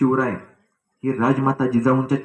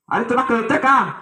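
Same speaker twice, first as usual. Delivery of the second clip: thundering guard; 9 semitones higher than usual. Acted. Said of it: "शिपाई कडाडला".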